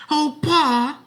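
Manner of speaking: put-on high, loud boys' shout; excited children's shout. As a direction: greet father joyfully. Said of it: "the boys shouted".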